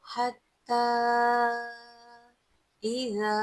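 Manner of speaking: slow chanted verse with long vowels; tajweed recitation. Shown as a demonstration. Teacher reciting the verse for students, slow melodic chant. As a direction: chant each word slowly and melodiously. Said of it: "तजवीद के साथ पढ़ेंगे".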